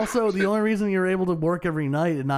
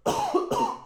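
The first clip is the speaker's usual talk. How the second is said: harsh coughing burst; coughing fit. Real, not acted. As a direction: cough hard into the mic. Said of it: "Sorry, I'm coughing".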